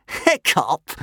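high-pitched mocking jeer. A narrator's reading. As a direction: jeer the name with scorn. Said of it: "jeered Snotlout".

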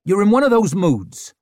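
loud, high irritated snap; snapping. A narrator's reading. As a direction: snap back at her. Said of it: "snapped Ricker".

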